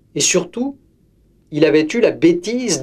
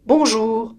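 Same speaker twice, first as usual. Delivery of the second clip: put-on higher child's greeting call; girl calling to grandmother, higher; 7 semitones higher than usual. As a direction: call into the room. Girl calls out.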